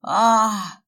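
loud mock howl of pain; jokingly faking a burn with a wild yell; hammed-up scream. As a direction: yell as if scalded, overplayed. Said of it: "заорал Фёдор нечеловеческим голосом".

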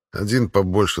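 ordinary delivery

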